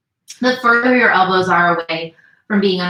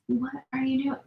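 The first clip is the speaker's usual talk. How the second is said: higher, much softer puzzled aside; soft question to pet; 6 semitones higher, 10 dB quieter than usual. Real, not acted. Teacher to cat climbing on her, soft high question. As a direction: quietly ask a misbehaving pet, pitched up.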